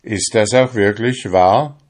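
ordinary delivery

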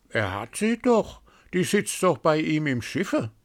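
a gossiping bird sneering; cawing raven voice. A narrator's reading. First raven to the others, high mocking caw.

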